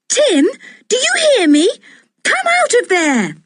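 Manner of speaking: high-pitched call down the hole; shouting. A narrator's reading. Girl shouting at her dog to come out. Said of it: "shouted George".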